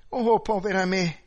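sudden loud, high cry of dismay; a flustered gasp at the forgotten oven; dismayed exclamation. Acted. The widow hearing the oven is still on, loud dismay. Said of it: "esclamò lei".

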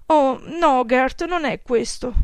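sighing. A narrator's reading. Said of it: "sospirò".

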